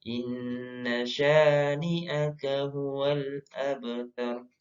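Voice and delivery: slow, measured Quranic recitation. Shown as a demonstration. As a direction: recite slowly, stretching the held sounds for learners to follow. Recites the verse. (mengaji tartil, pelan)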